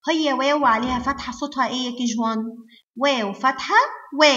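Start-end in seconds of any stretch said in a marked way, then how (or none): 4.2-4.3 "وا" as the letter's sound, wa, not its name. Shown as a demonstration. Teacher shows KG1 pupils the sound, "وا" voiced as wa. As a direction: say "وا" as a short open wa syllable. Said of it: "هنقول صوته على طول".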